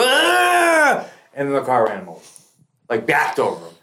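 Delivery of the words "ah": high drawn-out "ah" of a falling man; imitated yell. Storyteller imitating the man knocked over, high mock yell. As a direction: mimic his high cry as he goes over. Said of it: "you hear him go".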